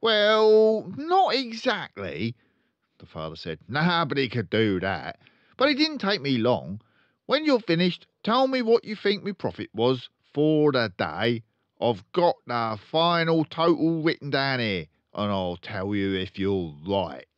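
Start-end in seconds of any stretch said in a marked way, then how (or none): 0.0-2.4 blustering, slow, high car-dealer dad; pompous father voice; loud pompous father. Acted. 3.7-17.3 pompous father voice; loud pompous father. Acted.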